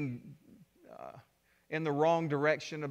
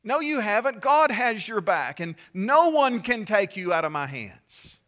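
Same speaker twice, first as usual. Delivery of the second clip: higher and louder, reassuring declaration; voicing the apostle John; 5 semitones higher, 7 dB louder than usual. John answers the doubting believer, loud and firm. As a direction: proclaim it like scripture speaking. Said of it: "And John is saying".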